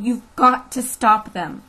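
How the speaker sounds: loud, drawn-out insistence; urgent plea. A narrator's reading. Teacher begs the sheriff to stop the mob, urgently. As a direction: insist with rising desperation. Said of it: "urged Catherine".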